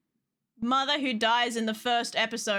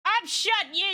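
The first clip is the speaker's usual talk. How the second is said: ordinary delivery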